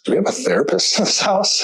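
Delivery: jumps high for the wife's joke; lightly voiced wife, teasing and high; wife's amused voice. Wife teasing from the doorway, a mock high voice.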